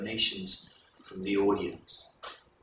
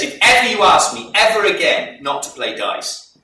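loud and higher, a king laying down the law; king's warning; 7 semitones higher, 10 dB louder than usual. Acted. King warning his wife never to refuse dice, loud and high. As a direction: push it up high and loud as a stern threat.

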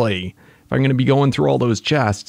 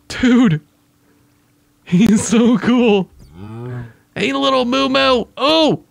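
cooing at a pet; 9 semitones higher than usual. Pretend. Cooing at the Minotaur mob.

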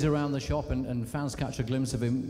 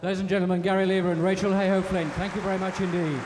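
louder, higher, through laughter; announcing; 6 semitones higher, 5 dB louder, faster than usual. Real, not acted. Host thanks the studio audience, rousing and louder. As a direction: call it out to the room.

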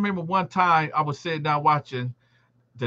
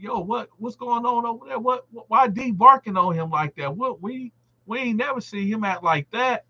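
higher, puzzled bystander voices; surprised onlookers; 7 semitones higher than usual. Retold or imitated. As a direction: play the shocked inmates watching the fight. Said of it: "Everybody looking over there like".

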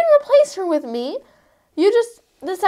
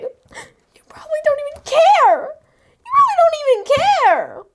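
tearful accusation; 8 semitones higher than usual. Acted. [name] sobs accusation at Moani.

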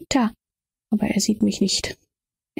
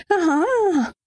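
high hysterical laugh, performed; high-pitched laughing cry; acted laugh; 10 semitones higher than usual. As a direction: burst out in a high staged laugh. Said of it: "Ich muss nur immer lachen".

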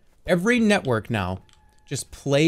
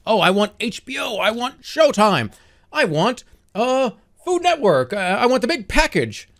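high, whiny imitation of demanding customers; hammed-up high-pitched wanting; mock cable customer; 8 semitones higher, 3 dB louder than usual. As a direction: mimic a customer listing wants like a spoilt kid. Mimics greedy cable customers.

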